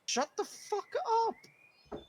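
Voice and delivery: high, slow put-on voice; high mock yell. Character tells the bowman to shut up. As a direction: drag out the insult.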